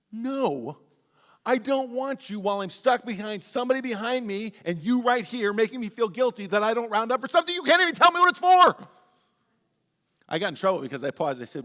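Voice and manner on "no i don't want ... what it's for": building louder, higher rant; exasperated rant. Retold or imitated. Pastor complaining to the congregation about round-up requests, loud exasperated rant. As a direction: vent it, getting louder to the end.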